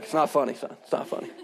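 low, soft, deadpan reprimand; mock stern parent. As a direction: quietly insist like an unamused parent. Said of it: "and I'm like".

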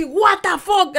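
loud, high retold "what the fuck"; jolted awake in shock; startled disbelief. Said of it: "Y me paré así". (sobresalto incrédulo, fuerte y agudo)

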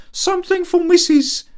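maid's voice. Acted. Sarah's errand quoted.

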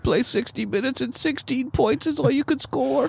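put-on high, soft disgusted old man; Al Davis impression. Host still doing Al Davis mocking the score. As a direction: sneer at the score like an old owner. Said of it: "my best Al Davis impersonation".

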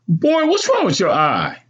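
high-voiced stern mother. A narrator's reading. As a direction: question the boy sternly, pitched high. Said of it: "she asked sternly".

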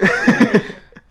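squealing laugh; high burst of laughter. Real, not acted.

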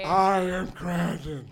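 put-on slow, stately old man introduction; old wizard Crandon. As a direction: announce himself grandly and slowly. GM voices Crandon.

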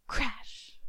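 crash sound. Acted. Millstone falls on her.

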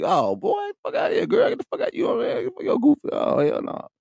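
high, fast mock-girl voice brushing him off; mocking dismissive girl. Mocking the ex-friends who brush him off, in a high dismissive girl voice. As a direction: squeal it out like she's done with him.

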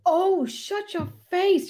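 high, slow, drawn-out shut your face; embarrassed groan. Real, not acted. To a friend recalling her sobbing, high slow mock protest. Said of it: "I do remember that".

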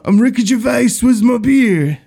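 loud, high, hammed-up British accent; terrible British accent. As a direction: belt out a bad British accent as an awards host. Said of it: "That was a terrible British accent".

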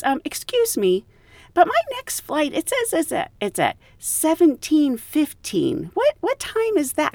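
high, puzzled voice of a confused passenger; clueless passenger. Retold or imitated. Flight attendant mimics a puzzled first-class passenger. Passenger quoted asking about time.

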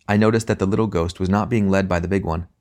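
usual speaking voice

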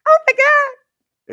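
put-on very high, slow, squeaky girl's voice; mock mousy girl voice. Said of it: "the high, squeaky, mousy voice".

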